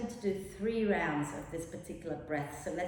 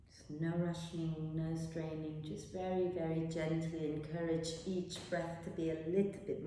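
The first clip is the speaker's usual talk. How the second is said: guided meditation; 3 semitones lower, 8 dB quieter than usual. Acted. Teacher guiding students into rest, soft meditation voice. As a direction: ease the class into rest, softly.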